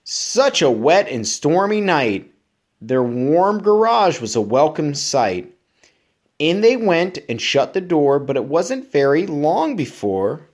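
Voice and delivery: lively, bouncy storybook reading; reading to children. Acted. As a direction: read it up to keep a child listening. Reads picture book aloud.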